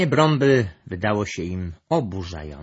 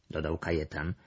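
ordinary delivery

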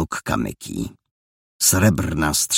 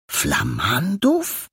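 slow, stretched question rising high; baffled echo; 7 semitones higher, much slower than usual. Acted. Boy gapes at an unknown word, baffled questioning. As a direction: repeat FLAMANDÓW slowly, puzzled.

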